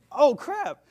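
high, loud jolt of alarm; alarmed outburst. Retold or imitated. Passenger spots a man with a crowbar, alarmed outburst. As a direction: blurt it out in mock panic.

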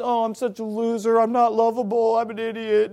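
put-on moping voice, a bit high; hammed-up poor-me lament; mock self-pity. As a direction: imitate someone wallowing in feeling like a loser. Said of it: "down in the dumps".

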